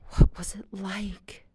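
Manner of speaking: slow, breathy near-whisper; almost whisper the question, slowly. A narrator's reading. Luke nervously asking Jen about the city, almost whispered. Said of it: "Luke almost whispered".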